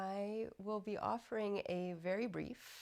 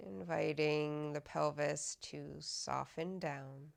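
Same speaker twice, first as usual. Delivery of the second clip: guided meditation; 4 dB louder than usual. Acted. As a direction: guide them unhurriedly into softening, evenly and calmly. Leads a body scan meditation.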